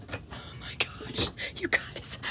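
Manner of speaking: breathless whisper of amazement; awed whisper. Real, not acted. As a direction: whisper it like you can't believe it. Amazed aside while discovering cabinet.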